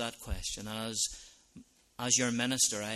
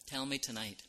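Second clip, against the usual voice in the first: ordinary talk, nothing marked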